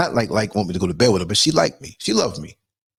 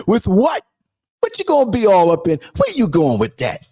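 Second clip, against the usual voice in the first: mock incredulous; 7 semitones higher than usual. Mocking comeback to neighbor.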